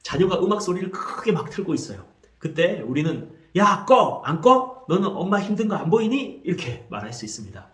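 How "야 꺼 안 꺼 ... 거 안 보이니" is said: loud, high mock yell; yelling parent. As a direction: shout at the kid to turn it off. Parent yelling over loud music.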